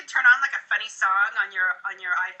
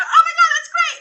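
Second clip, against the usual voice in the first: much higher and louder; thrilled; 10 dB louder than usual. Shown as a demonstration. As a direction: gush over it. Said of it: "I go".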